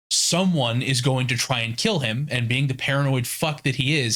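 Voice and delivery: normal talking voice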